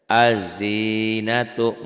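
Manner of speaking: Az-zinatu chanted slowly, doubled z; recited Arabic example. Shown as a demonstration. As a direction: sing Az-zinatu gently drawn out. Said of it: "nggak dibaca". (Az-zinatu dibaca pelan, lam tidak dibaca)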